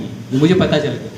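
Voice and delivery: normal talking voice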